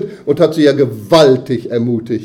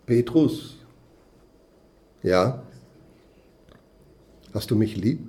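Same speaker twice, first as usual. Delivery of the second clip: soft, tender question; Jesus gently asking Peter, hushed and tender; gentle Jesus; 5 semitones lower, 8 dB quieter than usual. Pretend. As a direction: ask it quietly and lovingly, not as a rebuke. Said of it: "Kein ermahnendes Wort".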